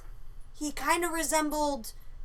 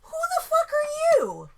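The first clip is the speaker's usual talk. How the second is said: high, loud, slow cry of shock; startled yell; 5 dB louder than usual. Retold or imitated. Woman confronting an intruder, shocked high yell. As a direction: yell it at a stranger on your toilet. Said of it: "And I'm like".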